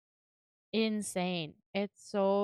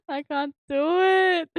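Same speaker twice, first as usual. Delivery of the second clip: embarrassed whine; 7 semitones higher, 8 dB louder, slower than usual. Real, not acted. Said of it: "I was gonna try and do Bakugo".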